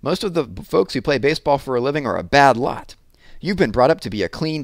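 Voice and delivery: a mother's earnest warning; scolding ma, a touch alarmed; worried mother. A narrator's reading. Mother warning against baseball.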